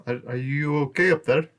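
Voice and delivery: tentative call from below. Acted. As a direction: call up to someone on a cliff. Calling up to Kaiji on cliff.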